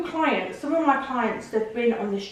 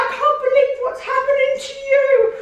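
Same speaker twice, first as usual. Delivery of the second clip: high, loud, quick mock fan voice; gushing woman; 6 dB louder, faster than usual. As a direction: play the overdramatic stranger again. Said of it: "And she was like".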